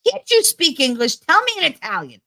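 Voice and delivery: high, rapid-fire grandma impression; confused, impatient grandma. Grandmother demands mother speak Italian, imitated high exasperation. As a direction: fire off baffled questions way up high. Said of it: "my grandma would be like".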